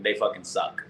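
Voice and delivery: usual speaking voice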